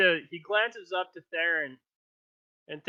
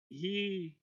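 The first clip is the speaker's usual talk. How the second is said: ordinary delivery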